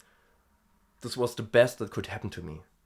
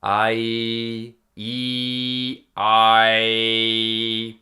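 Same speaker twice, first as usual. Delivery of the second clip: loud held I into E; twanged I and E; 9 dB louder, much slower than usual. Shown as a demonstration. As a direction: sing I then E, with twang. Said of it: "I does kind of end at the E".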